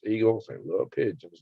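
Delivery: usual speaking voice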